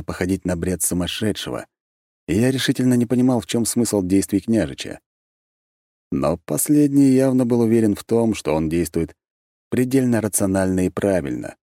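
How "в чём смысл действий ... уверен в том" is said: usual speaking voice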